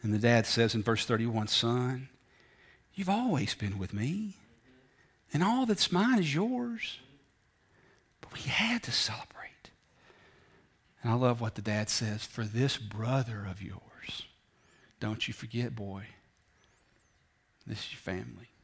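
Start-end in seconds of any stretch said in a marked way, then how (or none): 1.5-7.0 softly, slowly, a gentle father to his son; tender father. Acted. 8.2-9.7 breathy hushed whisper. Acted. Father's line whispered. 12.2-18.5 hushed father. Acted.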